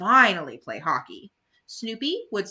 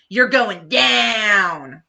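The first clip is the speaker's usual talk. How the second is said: slow, drawn-out louder jeer; taunting rival; 7 dB louder, much slower than usual. Acted. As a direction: menace before the faceoff. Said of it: "the rival taunts".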